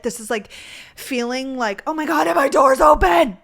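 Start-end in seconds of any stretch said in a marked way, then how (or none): 1.9-3.4 sudden loud alarmed exclamation, higher; real surprise, blurted out loud; startled outburst. Real, not acted. Vlogger noticing her door open mid-recording, startled loud outburst.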